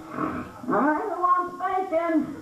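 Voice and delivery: high, slow, drawn-out whine; kid whining. Retold or imitated. Kid refusing grandma's bacon, high slow whine.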